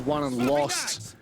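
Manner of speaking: ordinary delivery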